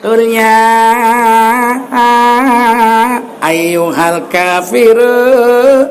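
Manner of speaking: slow, drawn-out mock Quranic chant; mock Quran recitation. Preacher mocks an imam in odd dress, slow drawn-out chant. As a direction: intone it with a slow, hammed-up drawl.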